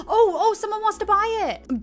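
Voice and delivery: high, excited exclamation; thrilled surprise. Real, not acted. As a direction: burst out high at the good news. First buyer appears.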